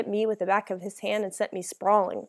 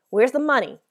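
raised, louder demand; higher, insistent interrogation; forceful demand; 4 semitones higher, 6 dB louder than usual. A narrator's reading. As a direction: demand it like a threatening robber. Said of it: "the man demanded".

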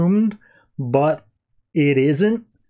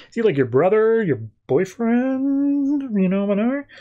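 coyly nosy, voice sliding up; teasingly fishing; 7 semitones higher than usual. Retold or imitated. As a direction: stretch out "boyfriend" high, feigning a casual question. Fishing about her relationship to Superman.

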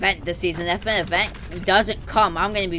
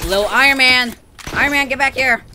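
loud chase call; 5 semitones higher, 7 dB louder than usual. Real, not acted. Chasing enemy player.

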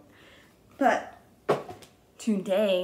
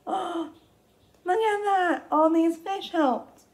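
much higher, slow delighted exclaim; hammed-up storytime delight; excited discovery; 9 semitones higher than usual. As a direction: show delight at the rescue.

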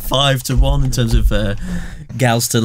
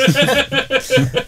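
high burst of laughter; 12 semitones higher than usual. Real, not acted. Laughing at own punchline.

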